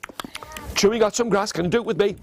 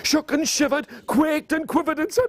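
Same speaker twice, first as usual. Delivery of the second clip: trembling storytelling; 6 semitones higher than usual. A narrator's reading. Narrates the goat's trembling fright.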